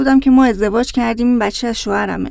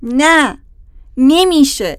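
raised-voice refusal; shouting; 3 semitones higher, 3 dB louder, much slower than usual. Acted. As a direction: cry out in protest, a little louder. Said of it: "داد زد".

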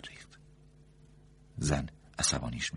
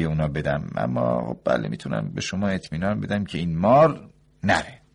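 glib doctor, higher and louder; smooth-talking; 5 semitones higher, 10 dB louder than usual. A narrator's reading. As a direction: talk the snake up smoothly. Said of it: "با چرب زبانی گفت".

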